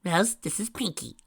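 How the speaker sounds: stern. A narrator's reading. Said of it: "Pinky said in a stern, stern voice".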